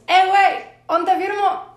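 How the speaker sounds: high, loud call across a distance; loud shout to a policeman across the street; calling from afar. Retold or imitated. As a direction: call out to the cop from far off. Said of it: "le hice así de lejos".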